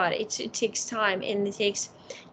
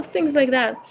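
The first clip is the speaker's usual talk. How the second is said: usual speaking voice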